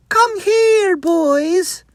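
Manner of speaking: high call toward the barn; old wife calling her sons from the barn. Acted. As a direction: call the boys in from afar.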